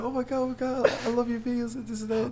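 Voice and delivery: very high, gushing fangirl voice; imitated freak-out. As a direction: gush like a starstruck cashier. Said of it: "She just started freaking out".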